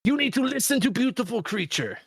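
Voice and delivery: high silly character voice; goofy voice telling kids to mind mom; high Salsa Man voice. Pretend. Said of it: "I'll be like".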